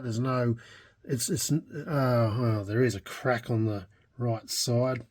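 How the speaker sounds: ordinary talk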